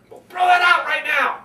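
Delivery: high, loud dismissal; emphatic shout. Shown as a demonstration. Speaker throwing out bad Toastmaster advice, emphatic high shout.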